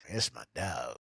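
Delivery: ordinary delivery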